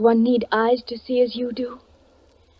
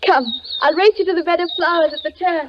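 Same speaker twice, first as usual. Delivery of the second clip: higher, eager and playful; playful invitation; 6 semitones higher than usual. Acted. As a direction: tease him into a race. Girl playfully invites race.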